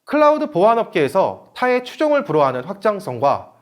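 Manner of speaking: ordinary delivery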